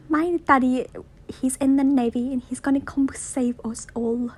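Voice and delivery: put-on high-pitched little-boy voice; Ralph boasting. Boy brags about his navy dad, high childish voice. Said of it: "Ralph is like".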